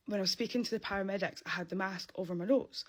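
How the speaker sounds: usual speaking voice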